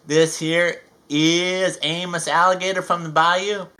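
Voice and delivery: usual speaking voice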